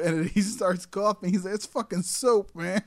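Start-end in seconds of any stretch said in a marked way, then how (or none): 1.5-2.8 higher, slow and louder stoner cry; a stoner finding out the coke is soap, slow high outcry; stoner realizing soap. Retold or imitated. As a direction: drag it out in disbelief. Voicing coughing character.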